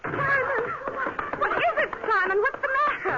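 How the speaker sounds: high, startled call of his name; high alarmed surprise. Acted. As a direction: caught off guard, asking what's wrong. Startled when play is stopped.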